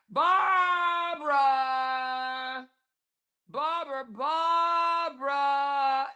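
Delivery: high, drawn-out mock call. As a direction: call her name across a field. Calling out for commenter.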